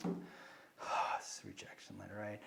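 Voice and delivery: breathy, soft resigned whisper; deflated whisper. Retold or imitated. Teen opening a letter he thinks is a rejection, whispered dread. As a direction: whisper it, expecting bad news.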